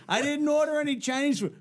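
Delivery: high-pitched mock protest; retold exasperation; exasperated denial. Called man protests repeated calls.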